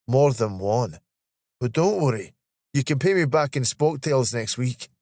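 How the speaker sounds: easygoing Scottish banter; teasing Scottish colleague. Acted. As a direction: let a friend off in broad Scots. Voices Steve, Scottish colleague.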